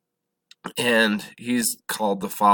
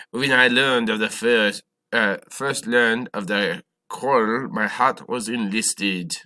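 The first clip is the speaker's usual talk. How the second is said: put-on terrible French accent; 3 dB louder than usual. Teacher quoting Lafayette to the class, bad French accent. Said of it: "that's my terrible French accent".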